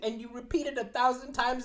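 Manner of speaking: usual speaking voice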